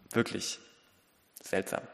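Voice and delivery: normal talking voice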